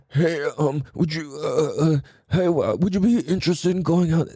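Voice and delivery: hesitant, halting ask with lots of uh; nervous stammer. Shown as a demonstration. Guy nervously asking his crush out, stammering. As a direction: nervously stumble through asking her out. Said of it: "just listen to the tone".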